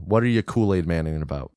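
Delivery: ordinary talk, nothing marked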